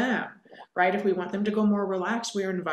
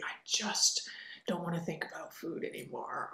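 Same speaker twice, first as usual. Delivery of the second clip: breathy repeated suggestion; whisper; 10 dB quieter than usual. Shown as a demonstration. Coach mirroring a client's words, whispered. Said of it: "repeating something a client has said".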